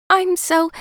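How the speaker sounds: high squeak like helium; squeaky high voice. Acted. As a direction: squeak it out, flustered. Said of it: "Her voice sounded like a mouse who'd sucked helium".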